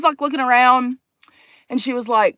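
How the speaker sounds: ordinary talk, nothing marked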